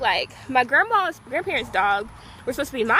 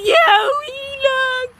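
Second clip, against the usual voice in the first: dreamy squeal; 12 semitones higher, 6 dB louder, slower than usual. Retold or imitated. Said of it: "I'm like".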